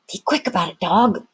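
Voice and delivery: ordinary delivery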